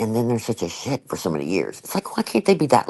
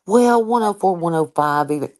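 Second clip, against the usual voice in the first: mock doctor; 7 semitones higher than usual. Mocking doctors' casual reply.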